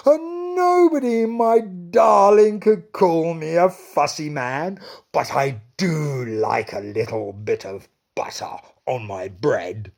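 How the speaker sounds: high, loud joyful declaration, calming at the end; king delighted to the queen; happy king. Acted. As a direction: crow it happily. King's happy final boast.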